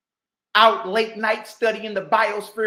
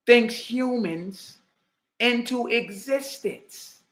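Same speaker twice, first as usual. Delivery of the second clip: slow, drawn-out repeat; mocking repeat; slower than usual. Repeating the scholar's phrase, slow and mocking. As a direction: spell the words out slowly in disbelief. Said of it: "This boy says".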